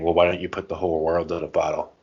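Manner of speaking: usual speaking voice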